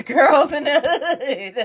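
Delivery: breaking into laughter through the words; laughing. Real, not acted. Host mocks the song title to viewers, laughing. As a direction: laugh your way through the question. Amused at own rhetorical question.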